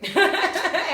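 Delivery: high, loud burst of real laughter. Real, not acted. Laughing at her own haircut disaster with cohost, loud real laugh.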